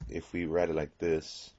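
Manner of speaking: usual speaking voice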